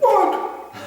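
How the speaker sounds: high, loud burst of disbelief; shocked what. Retold or imitated. Devotees hearing he sold hundred eight books, high, loud disbelief. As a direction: shoot it up in shock. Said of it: "they were like".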